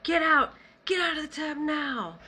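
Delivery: 高く張った声で急き立てる命令; urgent command. Retold or imitated. Retold grandmother ordering her out.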